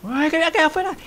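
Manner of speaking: high, loud dismayed cry; real alarm at being left out; loud high wail. Real, not acted. Caught outside the zone.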